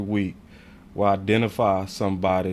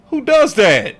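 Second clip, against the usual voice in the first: much higher and louder, fed up; angry rant; 11 semitones higher, 10 dB louder than usual. Real, not acted. Host ranting to chat about praising a written-up worker, angry and loud. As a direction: hammer it home like a fuming boss. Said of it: "I'm sorry to get angry".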